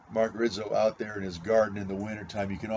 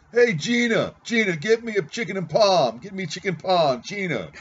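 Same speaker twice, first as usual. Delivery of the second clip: put-on loud, high Brooklyn accent; 8 semitones higher, 7 dB louder than usual. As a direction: bark the order in a Brooklyn accent. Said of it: "Brooklyn accent, he thinks it's Italian".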